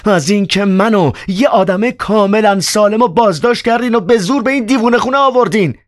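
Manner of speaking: very high, indignant, strained; angry reply. Acted. Ivan angrily protesting to the doctor, very high. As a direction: protest furiously in a high pitch. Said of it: "با عصبانیت جواب داد".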